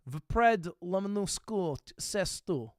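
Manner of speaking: slow, put-on foreign accent, as if a local; mock French-style accent reading; fake foreign accent. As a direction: read the French words in a mock native accent. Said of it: "I'm saying it with, like, an accent".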